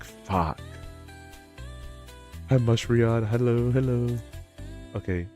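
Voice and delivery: ordinary delivery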